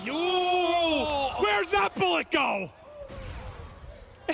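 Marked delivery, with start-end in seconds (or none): 0.1-2.7 excited caster call. Real, not acted. Reacting to a shot.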